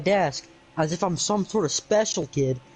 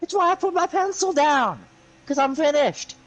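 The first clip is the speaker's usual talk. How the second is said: shrill-pitched exasperated outburst, much higher; exasperated high yell; 9 semitones higher than usual. Retold or imitated. A student snapping at hovering teachers, exasperated and high.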